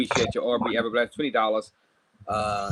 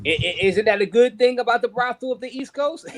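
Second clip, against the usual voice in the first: higher, mock-innocent question; teasing; 8 semitones higher than usual. Real, not acted. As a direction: needle him with a joke. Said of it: "I'm just playing".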